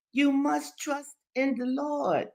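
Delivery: put-on high, slow old man's plea; exhorting Daddy Joe. A narrator's reading. Old man urges Henry to trust, exhorting. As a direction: stretch it out in a high, insistent voice.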